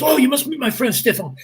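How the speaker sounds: high, eager imitated French accent; French gentleman. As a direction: play the excitable French host. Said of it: "this French gentleman".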